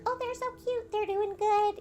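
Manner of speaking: high sweet cooing over the babies; cooing ultrasound lady. Retold or imitated. Mom imitating the ultrasound lady's high cooing. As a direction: gush like a sonographer charmed by twins.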